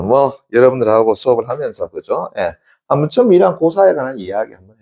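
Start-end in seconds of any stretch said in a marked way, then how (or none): none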